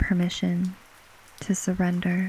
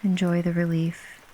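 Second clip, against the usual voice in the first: ordinary delivery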